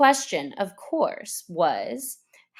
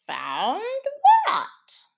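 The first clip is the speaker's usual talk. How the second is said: lightly voiced puzzled Duck; 12 semitones higher, 4 dB louder than usual. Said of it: "said the Duck".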